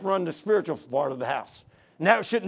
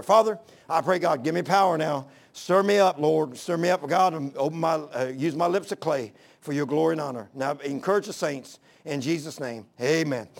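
prayer; 4 semitones lower than usual. Real, not acted. Leads the congregation in prayer.

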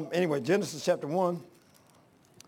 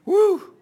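high, loud whoop of delight; joyful whoop; 13 dB louder than usual. Real, not acted. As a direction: let out a happy woo like hearing heaven sing. Preacher imagining heavenly singing.

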